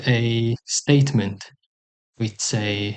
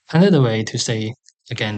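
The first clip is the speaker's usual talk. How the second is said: ordinary talk, nothing marked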